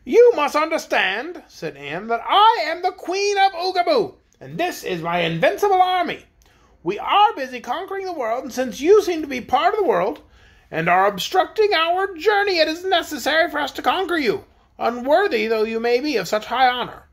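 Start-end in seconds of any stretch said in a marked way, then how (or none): 0.0-1.4 high, loud, slow announcement; haughty queen. A narrator's reading. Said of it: "strutting in their most haughty manner". 2.1-17.1 high-pitched, pompous royal proclamation; haughty conquering queen. A narrator's reading.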